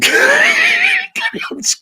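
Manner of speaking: high screaming cackle; shrieking laugh. Real, not acted.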